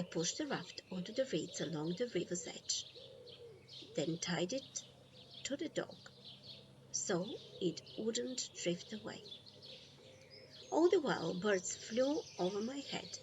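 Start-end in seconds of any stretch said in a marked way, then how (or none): none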